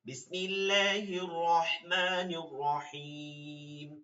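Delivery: slow, melodic bismillah; teacher opens the surah with a recited bismillah; Quran recitation. Acted. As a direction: chant the opening slowly.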